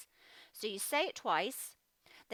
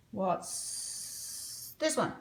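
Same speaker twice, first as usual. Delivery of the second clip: whisper; much slower than usual. Shown as a demonstration. Teacher whispering the quiz question to the class. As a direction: breathe the question slowly.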